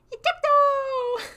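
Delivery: high, slow, drawn-out call; mock hype. Streamer naming the move, drawn-out hype call. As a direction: stretch it like a cartoon announcer. Shouted sound effect.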